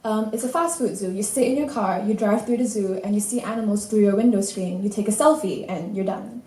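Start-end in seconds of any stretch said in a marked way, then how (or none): none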